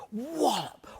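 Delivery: ordinary talk, nothing marked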